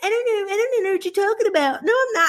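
high-pitched mock protest; imitated angry denial. Coach mimicking her angry client on the call, high-pitched mock denial. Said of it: "you were so freaking angry".

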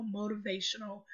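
ordinary delivery

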